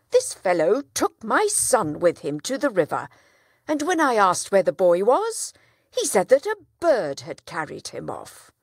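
loud, indignant plea to the judge; complaining father. Acted. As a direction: press the accusation hard. Town trader complains to judge.

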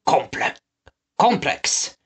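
thoughtful whispered then voiced; musing. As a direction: ponder the taste aloud. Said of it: "mused one of the men".

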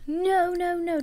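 high, drawn-out run of no's; urgent protest. Real, not acted. Player stopping a character from touching her, urgent protest.

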